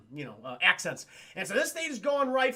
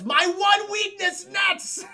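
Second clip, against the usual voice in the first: much higher, louder, slow hammy shout; overacted B-movie despair; melodramatic villain wail; 12 semitones higher, 9 dB louder, slower than usual. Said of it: "Jon Voight says".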